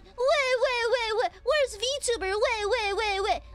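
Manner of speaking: high mock baby wail; mock crying. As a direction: wail it out, mocking whiny crybabies. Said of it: "Kept crying".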